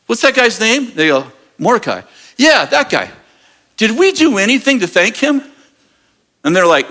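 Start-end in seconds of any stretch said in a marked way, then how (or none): 0.1-5.4 higher king voice. Retold or imitated. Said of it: "and he goes".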